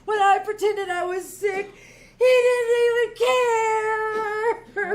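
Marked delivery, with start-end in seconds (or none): 2.2-4.9 slow, louder tearful lament; tearful wail that he didn't even care; crying. Acted. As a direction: sob it out slowly, hurt and sorry for herself. Wife hurt husband didn't care.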